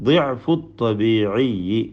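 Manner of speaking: slow, measured Arabic definition read like Quran recitation. Shown as a demonstration. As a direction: draw out each Arabic word carefully, slowly. Quoting Arabic term. (പതുക്കെ, അറബി പാരായണ ശൈലിയിൽ)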